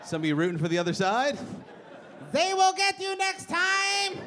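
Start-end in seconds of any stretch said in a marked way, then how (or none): 2.3-4.2 very high, slow, drawn-out mock threat; a squeaky taunt stretched out slowly. Mock threat to soccer fans.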